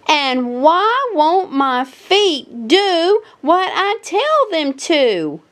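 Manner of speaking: a small bunny, frustrated; little rabbit Daisy. A narrator's reading. Daisy voiced in story.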